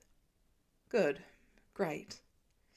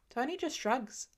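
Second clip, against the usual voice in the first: usual speaking voice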